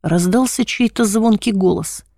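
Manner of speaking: ordinary delivery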